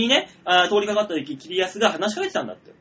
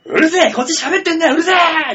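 高く張り上げた怒りのツッコミ; 邪魔者を大声で怒鳴りつける演技; 怒鳴り声の再現; 10 semitones higher, 7 dB louder than usual. Retold or imitated. 邪魔されて怒鳴り返す芸人の再現. (芝居がかった怒鳴り返し)

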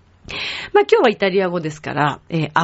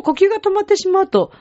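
ordinary talk, nothing marked

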